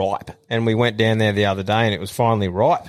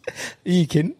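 imitating shocked dad; 7 semitones higher, much faster than usual. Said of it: "and he goes".

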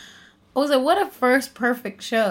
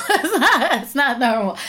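breaking into high, loud laughter; laughing disbelief; 5 semitones higher, 7 dB louder than usual. Real, not acted. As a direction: say it through a rising burst of laughter. Laughing at her own amazement.

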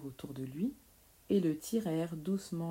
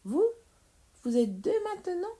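high, rising astonished question; incredulous child's question; startled disbelief; 12 semitones higher, 4 dB louder than usual. Acted. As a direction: squeak it out in sheer surprise. Startled boy finds two witches.

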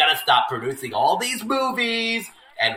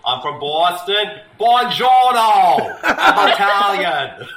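put-on Boston-Italian mash-up accent, drawn out; hammed-up Italian Bostonian; Mark Wahlberg; 3 semitones higher, 3 dB louder, slower than usual. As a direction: belt out a badly mixed Boston-Italian accent. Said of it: "how would Mark Wahlberg sound if he was Italian".

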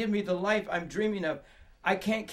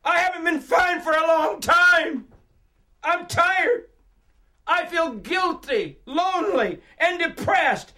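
high, loud outburst of pent-up confession; full-voiced, exasperated yell; 9 semitones higher, 7 dB louder, slower than usual. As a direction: shout it at a silent room, defiant and fed up. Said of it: "So I yell out".

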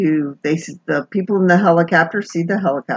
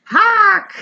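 high squawking cry of hawk!; comic screech of hawk!; mock squawked hawk; 3 dB louder than usual. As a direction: shriek it like a bird of prey. Bird in charge, mock shout.

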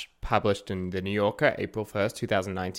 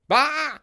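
high, sharp "bah" of disgust; exasperated bah; 4 dB louder than usual. Acted. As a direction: scoff in disgust. Exasperated outburst.

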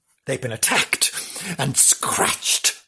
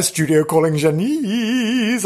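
calling out; 5 semitones higher, slower than usual. Pretend. Presenter calling a remote reporter.

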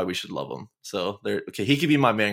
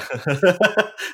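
a high, loud laugh breaking out; burst of laughter; 10 semitones higher, 7 dB louder than usual. Real, not acted. Gushing about McGinn, he bursts out laughing loud. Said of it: "I'm getting emotional".

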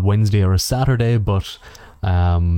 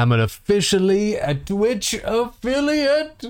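high, slow, proud declaration; grandly declaring his Twitch affiliate news; proud announcement; 10 semitones higher, slower than usual. Real, not acted. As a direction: announce it like a big milestone.

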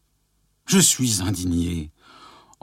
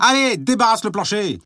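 angry order; 12 semitones higher than usual. Acted. Ordering wife out again.

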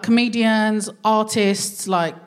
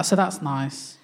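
ordinary talk, nothing marked

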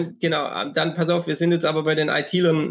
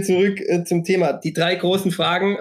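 ordinary talk

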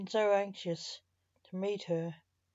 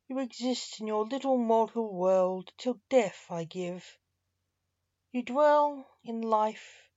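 the Reaper's voice; 2 semitones higher, 5 dB louder than usual. Acted. Death addresses Vivian directly, solemn and reproachful.

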